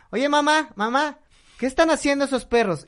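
put-on high child voice asking mom; curious kid. Pepito asking his mom about the dogs, high. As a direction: pitch up like a nosy little boy.

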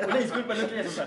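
giggled-through apology from the dog; playful laughing apology; laughing mock apology. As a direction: laugh while saying sorry, a bit fast. Joking apology voiced for dog.

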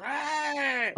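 high mock shout; mock yell. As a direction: yell it like a madman. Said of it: "gritando como locos". (grito burlón agudo)

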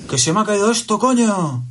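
put-on high child's voice, cursing; squeaky toddler swearing casually; small kid saying coño; imitating his daughter. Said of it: "lo utilizaba en los momentos".

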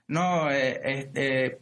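usual speaking voice

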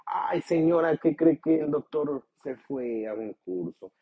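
voz de secretaria, mucho más aguda y algo más fuerte; secretaria imitada. Retold or imitated. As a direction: imita a una secretaria amable al teléfono. Secretaria explica ausencia del doctor. (secretaria fingida con voz aguda)

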